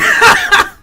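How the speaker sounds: high, loud incredulous laugh; shrieking laugh; loud laugh of disbelief. Real, not acted. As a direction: burst out laughing at the absurdity.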